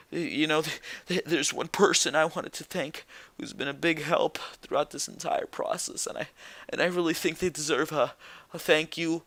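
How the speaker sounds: quoting her teary promo in a soft, hushed voice; mock tearful. Mocking Carmella's emotional promo to viewers, soft fake-teary voice. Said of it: "she starts crying and she gets emotional".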